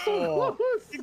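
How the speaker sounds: high, loud so close; excited shout. Real, not acted. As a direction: cry it out at the near miss. Reacting to replay.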